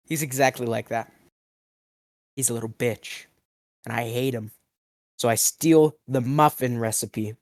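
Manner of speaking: ordinary delivery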